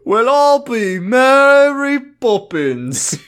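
loud, high, drawn-out Cockney exclamation; hammed-up Cockney astonishment; mock Cockney. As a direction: belt it out slowly in fake Cockney. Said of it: "Australian and Cockney".

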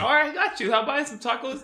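acting out a quick, keen deal; eager agreement. Retold eager agreement with King.

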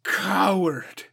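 loud, high, fast tirade; raging insults. A narrator's reading. Suko berates Toturi as a coward, raging. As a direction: tear into him.

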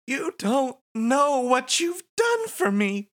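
high, gushing wife voice; Nadine voice. Acted. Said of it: "she says".

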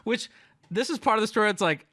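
ordinary delivery

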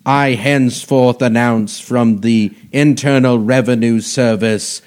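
booming hear ye proclamation; bailiff. Pretend. Mock court proclamation, hear ye.

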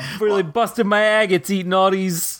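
put-on light Italian accent, stuffed full; a hint of an overfed Italian. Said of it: "you did a very Italian accent".

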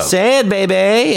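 put-on high, drawn-out "baby" ending on a big "bay"; slow, rising "baby" with a long A; heavy A in baby. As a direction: lean hard on the A in "baby". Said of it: "And again with the A".

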